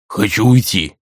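a sulky grumble; grumbling. A narrator's reading. Sulking spirit Eruda to Rei, grumbling. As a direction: grumble it out sullenly. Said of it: "буркнул тот".

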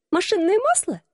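high squeaky question; squeak. Acted. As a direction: squeak it out in alarm. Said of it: "пискнула я".

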